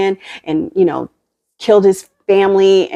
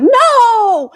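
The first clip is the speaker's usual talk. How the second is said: high, loud mock cry of no; imitated cry; 4 dB louder than usual. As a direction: fling out a high no. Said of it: "Mary is like".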